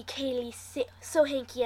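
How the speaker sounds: usual speaking voice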